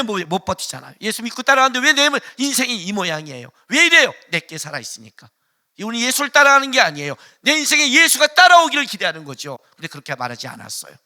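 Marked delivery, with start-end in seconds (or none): 1.0-9.6 loud, high, fervent preaching; fiery pulpit preaching; preaching at full force. Acted. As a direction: thunder it at the congregation.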